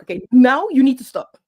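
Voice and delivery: loud firm "stop", no nonsense; firm retold order. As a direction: put your foot down with the coach. Standing up to shouting coach.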